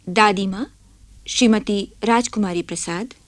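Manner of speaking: ordinary talk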